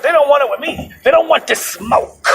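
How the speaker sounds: high-pitched mock-tough boast; mocking high female voice; mimicked tough woman. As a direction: draw it out high, imitating her swagger. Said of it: "And I said".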